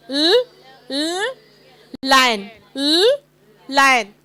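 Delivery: l l then lion, stressed; sounding out l. Shown as a demonstration. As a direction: isolate l before lion. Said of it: "What is the sound?".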